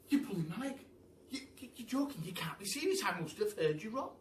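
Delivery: high, disbelieving and giddy; thrilled monkey. Acted. The monkey can't believe the Duke has walnuts, giddy. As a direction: gush over the word in delight.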